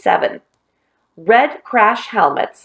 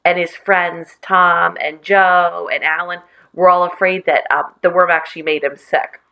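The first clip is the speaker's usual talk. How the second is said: ordinary delivery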